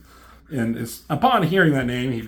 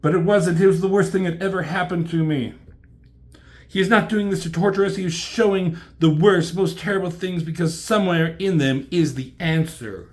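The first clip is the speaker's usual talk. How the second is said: raised, emphatic stage voice; earnest dramatic heroine; 5 semitones higher, 5 dB louder than usual. Acted. Elektra defending Gateway's showing, high and intense. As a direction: insist on it with theatrical gravity.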